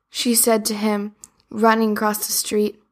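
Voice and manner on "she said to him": plain reading voice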